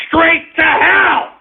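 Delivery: high, slow, drawn-out shout; ranting shout. Real, not acted. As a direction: bellow it out slowly like damnation. Ranting about Trudeau and hell.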